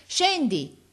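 high, falling command; repeated order. A narrator's reading. Officer orders boy down, calling. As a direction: call the order up insistently.

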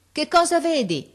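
high, loud shouted question; called-out question. A narrator's reading. Officer asks the lookout boy, calling out. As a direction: call it up into the treetop.

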